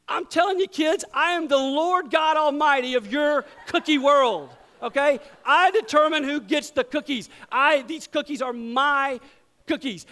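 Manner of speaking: loud, high hammed-up lordly decree; mock god proclamation. Dad declares to kids he rules the cookie world. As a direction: thunder like a mock almighty.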